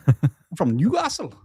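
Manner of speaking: slow Geordie accent, "Newcastle" said the Geordie way. Shown as a demonstration. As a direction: draw out "I'm from Newcastle" in a mock Geordie accent. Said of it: "trying to do a Geordie accent".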